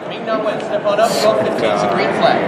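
loud crowd caller; loud staff guy. Retold or imitated. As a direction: call out names to a crowd. Imitating the staff guy calling.

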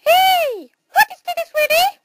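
tiny, very high-pitched mouse squeak. Acted. Mouse character questioning Toby, very high squeaky mouse voice. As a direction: talk like a sped-up cartoon mouse. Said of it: "no more mouse voice".

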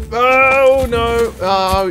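ordinary delivery

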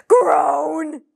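big loud frustrated groan. Acted. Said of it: "kept getting yelled at".

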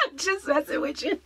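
ordinary talk

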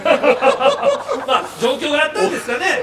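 high, loud laughing words. Real, not acted. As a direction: burst out laughing while asking. Laughing at the odd impression.

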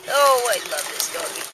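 ordinary delivery

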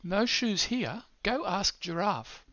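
put-on playful read to children; animated storybook voice. Shown as a demonstration. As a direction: lift the voice up high for the giraffe. Character line read for app.

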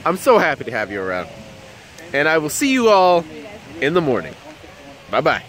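ordinary talk, nothing marked

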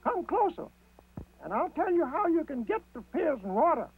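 high, conspiratorial rabbit voice; Brer Rabbit. A narrator's reading. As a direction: draw them in as the sly rabbit. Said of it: "said Brer Rabbit".

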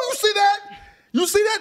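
high, loud excited hollering; 1 hyped crowd cheering. Retold or imitated. Teammates hollering over a big hit, high and excited. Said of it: "everybody was like".

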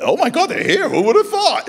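high, loud, giddy rush; excited squeal. Retold or imitated. Retelling his own joy at the soldiers arriving, high excited squeal. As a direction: squeal it like the guests just walked in.

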